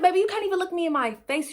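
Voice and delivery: high, fast mocking "Baby"; sassy call-out. To Scary who won't meet her eyes, fast sassy taunt. Calling out Scary in front of cameras.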